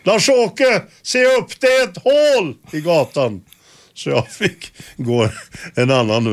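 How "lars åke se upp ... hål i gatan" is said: high shouted warning across the street; high urgent call of warning. Retold or imitated. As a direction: yell it across the street to stop him. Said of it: "Så hon skrek över gatan där".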